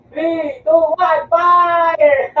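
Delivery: loud, high countdown building to a yell; shouted countdown. Real, not acted. Player cueing the team to fire, shouted countdown. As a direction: count down and yell the final word.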